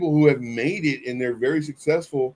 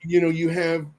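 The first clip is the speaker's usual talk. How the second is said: ordinary talk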